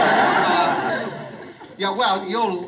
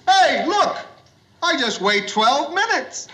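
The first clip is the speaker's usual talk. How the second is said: excited tipsy exclamation for the joke's payoff; drunk in a joke; 9 semitones higher than usual. Retold or imitated. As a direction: ham up the drunk's punchline. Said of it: "he says".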